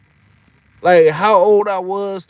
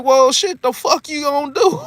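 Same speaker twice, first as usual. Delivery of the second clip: higher, laughing cocky challenge; laughing Mike quote; 11 semitones higher, 4 dB louder than usual. Retold or imitated. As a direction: quote Mike while cracking up. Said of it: "He's like".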